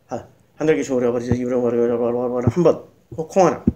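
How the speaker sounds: low, rapid rattled-off prayer; rote prayer recitation. Shown as a demonstration. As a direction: rattle through a memorised prayer, low and hurried. Demonstrates counting rote repeated prayers. (낮은 목소리로 기도문을 빠르게 줄줄 외우기)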